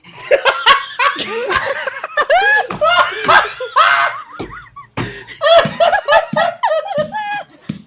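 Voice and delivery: high, shrieking belly laugh; helpless howling laughter at the table; loud roaring laughter. Real, not acted. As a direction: lose it completely, laughing loud. Player cracks up after line.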